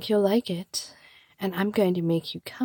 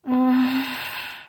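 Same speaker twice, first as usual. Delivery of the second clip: long, higher-pitched sighing moan; 4 semitones higher than usual. Acted. After saying she needs the listener, drawn-out moan. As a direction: let a moan sigh out slowly. Performed sigh of desire.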